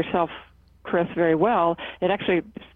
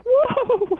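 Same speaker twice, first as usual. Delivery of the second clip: high-pitched playful yelp of shock; pretend icy shock; 4 dB louder than usual. Acting out imaginary snow down the back, high startled whoop. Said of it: "when you have ice and snow down your back".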